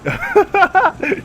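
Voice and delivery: a loud, high burst of laughter; big laugh. Real, not acted. Streamer laughing at the fleeing character, loud high laugh. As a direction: laugh out loud at your own joke. Laughs after chasing Bélec.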